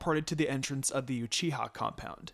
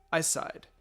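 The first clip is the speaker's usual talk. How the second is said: usual speaking voice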